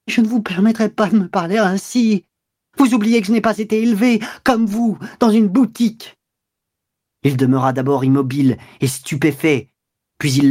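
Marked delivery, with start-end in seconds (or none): 0.1-6.2 tragic indignation. Acted. The wife retorting to her husband with outraged pride. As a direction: draw herself up and lash back. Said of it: "Elle se dressa, tragique".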